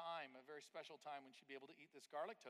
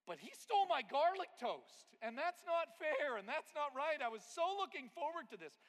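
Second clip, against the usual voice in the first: much higher and louder complaining sister; sister's classic whine; 11 semitones higher, 13 dB louder than usual. Retold or imitated. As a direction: whine it like a wronged little sister. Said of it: "said in her classic way".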